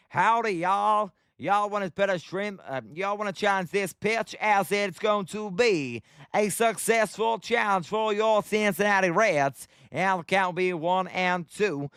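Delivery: mock cowboy twang, stretched out; cowboy accent. Streamer calls the game as a cowboy on request, cowboy accent. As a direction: play at a drawling cowboy. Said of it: "You want me to have a cowboy accent?".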